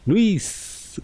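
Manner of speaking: Luis sung out high and stretched, with a leap up; emphatic name. Retold or imitated. Coworker greeting him pointedly by his real name, high emphatic Luis. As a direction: land hard on Luis to show he learned the name. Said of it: "como que poniéndole énfasis".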